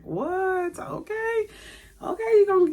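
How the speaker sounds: high stunned okays; surprised. Retold or imitated. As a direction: stammer it out in shock. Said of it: "she was just surprised".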